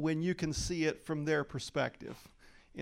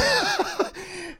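short real laugh, much higher and louder; 6 dB louder than usual. Real, not acted. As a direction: laugh out high. Laughing at own reaction.

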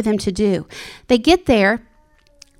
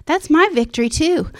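higher, eager declaration of victory; joyful claim; 6 semitones higher than usual. Retold or imitated. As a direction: claim the victory with rising joy. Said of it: "And I'm like".

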